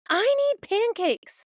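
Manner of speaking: put-on high, slow whiny child demand; demanding kid voice. Toddler yelling at mom for her blue cup, high whiny demand. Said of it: "they're yelling demands".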